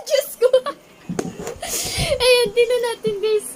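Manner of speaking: high, loud, laughing through words; talking while cracking up. Real, not acted. (tumatawang nagsasalita)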